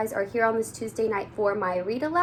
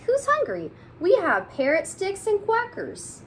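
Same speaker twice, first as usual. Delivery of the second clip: much higher, sing-song; high teacher voice; 12 semitones higher, 3 dB louder than usual. Acted. Teacher offering snacks to the class, high and sing-song.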